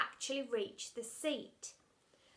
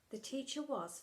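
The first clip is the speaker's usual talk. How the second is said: ordinary delivery, nothing marked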